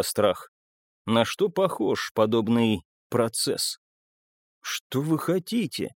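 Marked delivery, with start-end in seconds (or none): 4.6-5.9 higher, puzzled question; bewildered question. A narrator's reading. Bessik baffled by the narrator's offer, sincerely bewildered. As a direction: ask in genuine bewilderment. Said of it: "он глядел с искренним недоумением".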